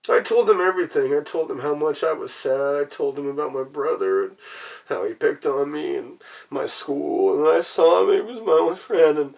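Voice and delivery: tearful lament. Real, not acted. Recalling the only friend at school, tearful lament. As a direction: pour it out through grief.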